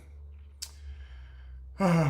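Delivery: long, voiceless exhale; long sigh. Real, not acted. Coach pausing mid-advice to a lifter, long sigh. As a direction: let out a long breath mid-thought. Sighs midway through advice.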